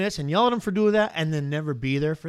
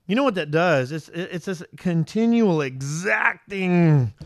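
ordinary talk

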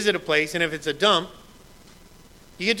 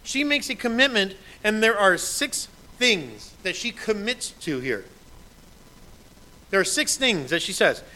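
ordinary delivery

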